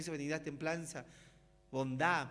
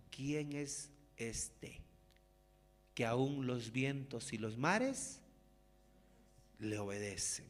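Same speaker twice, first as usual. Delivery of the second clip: soft, slow, hushed disciples' wonder; awed quiet question; 11 semitones lower, 8 dB quieter, slower than usual. Retold or imitated. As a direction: murmur it slowly, amazed at the calm sea. (pregunta asombrada, lenta y baja)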